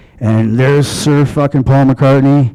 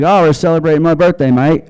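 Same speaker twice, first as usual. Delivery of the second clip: quick, raised British lilt; mock British accent; 5 semitones higher than usual. Diner answers [name], copying his British accent. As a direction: play at being a Brit, a bit higher and faster. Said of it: "I started speaking like a Brit".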